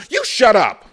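high, loud furious outburst; angry shout. A narrator's reading. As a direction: cut him off with a yell. Armed boy silencing Sam.

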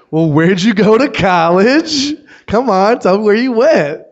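high, loud, drawn-out prying; mock nosy insistence. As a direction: badger them like a pushy stranger. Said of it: "curious about everything".

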